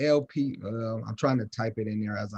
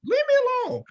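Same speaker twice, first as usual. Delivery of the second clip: put-on much higher, louder voice of an irritated boy; annoyed kid; 6 dB louder, faster than usual. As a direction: play the rowdy son brushing off mom. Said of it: "I'm like".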